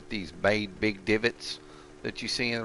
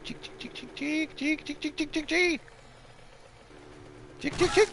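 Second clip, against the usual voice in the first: high chick-chick calling; calling chickens. Pretend. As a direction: call the chickens, high and quick. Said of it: "kick out of doing that".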